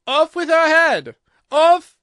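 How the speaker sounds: very loud, high, drawn-out royal command; enraged Queen at full blast; furious scream. A narrator's reading. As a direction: scream the order in a fury.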